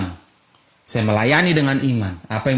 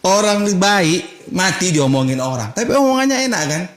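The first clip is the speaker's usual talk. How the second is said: stretched sermon punchline; emphatic preaching; 8 semitones higher than usual. Acted. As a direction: preach it to the congregation.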